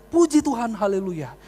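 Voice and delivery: high, loud cry of praise that drops away; retold shout. Preacher re-enacting the cry that woke him, retold shout. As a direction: shout it high, then let it fall. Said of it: "ketika saya teriak".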